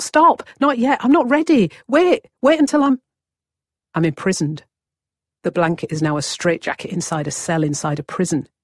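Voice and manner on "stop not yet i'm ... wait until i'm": high, loud panicked yelling; desperate shout. Acted. Newborn begging the world to wait, loud high shouts. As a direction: shout to stop it all, far higher and louder. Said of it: "I want to shout".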